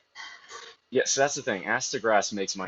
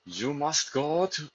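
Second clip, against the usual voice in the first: put-on higher, slow, half-hearted angry German accent; attempted German accent; 7 semitones higher, slower than usual. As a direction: start a mock angry German accent, then give up. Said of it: "I can't do the angry German accent".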